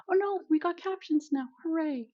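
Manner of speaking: high, delighted relief; excited. Real, not acted. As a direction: squeal with happy surprise. Captions suddenly start working.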